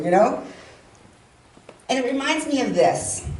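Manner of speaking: ordinary talk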